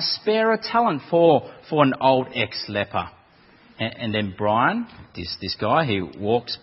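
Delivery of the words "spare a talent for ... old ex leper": ordinary delivery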